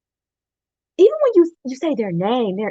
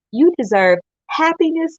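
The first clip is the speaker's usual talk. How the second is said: higher, louder passionate push on "You deserve happiness"; 7 semitones higher, 3 dB louder than usual. Acted. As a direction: preach it to lift them up. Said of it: "I was a little passionate there".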